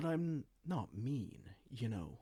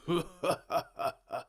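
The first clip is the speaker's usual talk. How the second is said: villain laugh; 5 semitones higher, 4 dB louder than usual. Acted. Villain gloats over choking chancellor.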